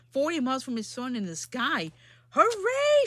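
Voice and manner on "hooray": high, loud cheer; shout. Acted. Yertle on his throne, loud high cheer. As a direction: shout with glee. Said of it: "shouted Yertle".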